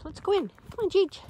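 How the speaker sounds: high, bright dog-calling voice. Real, not acted. Calling her service dog.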